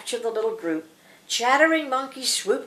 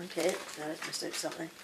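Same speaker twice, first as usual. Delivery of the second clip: low, much softer aside; quiet apology; 4 semitones lower, 10 dB quieter, faster than usual. Real, not acted. Reader apologises to Mike for stopping, in a quiet aside. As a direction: drop out of the storytelling voice, real and offhand.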